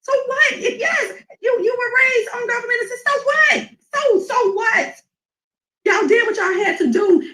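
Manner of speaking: rising fervour, like a preacher at full force; impassioned preaching. Real, not acted. Urging her friend to own her past, impassioned.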